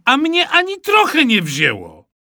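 put-on high, earnest child's voice; child explaining. Mikołaj explains to his mother, high and earnest. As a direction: plead your case like a small boy. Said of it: "wyjaśniłem".

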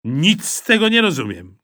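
mother losing patience with son; exasperated mother. A narrator's reading. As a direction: throw up your hands in words. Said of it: "krzyknęła mama Kleofasa".

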